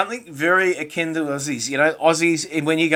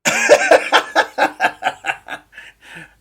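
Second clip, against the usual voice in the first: high wheezing laugh; 8 dB louder than usual. Real, not acted. Laughing at cohost's joke.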